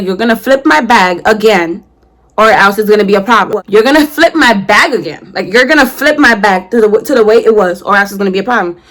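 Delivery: ordinary talk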